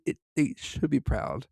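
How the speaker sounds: usual speaking voice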